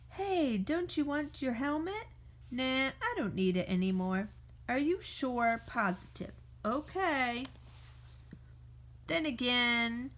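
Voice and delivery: ordinary delivery, nothing marked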